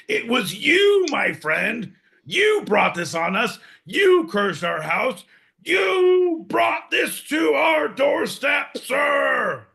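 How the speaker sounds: building from high and slow to a full high shout; hammed-up accusation. Host accusing John of cursing the house, building to a hammed-up shout. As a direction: play the betrayed detective unmasking the culprit.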